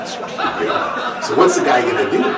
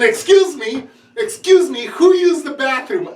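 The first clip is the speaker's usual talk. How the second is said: high demanding mom voice; mom's stern question; 12 semitones higher than usual. Retold or imitated. Mother demanding who used the bathroom, high and insistent. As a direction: ask like a mother who caught someone.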